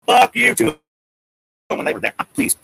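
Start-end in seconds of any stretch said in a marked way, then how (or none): 0.1-0.8 high mock yell of defiance; defiant yell. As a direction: yell it up at the helicopter, higher. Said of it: "I wish I yelled".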